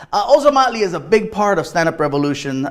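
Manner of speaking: normal talking voice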